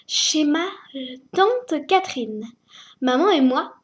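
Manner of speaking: ordinary delivery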